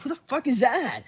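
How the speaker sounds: loud, high-pitched bewildered demand; startled yell. Retold or imitated. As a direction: reenact a startled shout. Retold startled reply in street.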